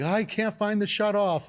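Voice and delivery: high, loud frantic cry; retold panicked old plumber. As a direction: voice him in a panic. Plumber panicking over gas leak.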